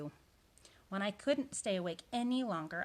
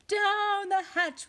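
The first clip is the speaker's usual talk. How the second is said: higher, drawn-out echoing shout; crew shouting; 13 semitones higher, 8 dB louder, much slower than usual. A narrator's reading. Crew answers captain at dinner, high shouting. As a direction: shout it back high and loud. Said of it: "we all shouted".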